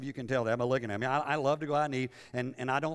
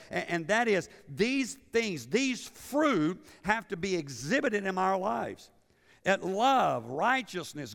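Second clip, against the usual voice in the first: raised, emphatic pulpit preaching; 7 semitones higher, 5 dB louder than usual. Acted. Pastor urging the congregation, emphatic preaching. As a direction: drive each point home to the pews.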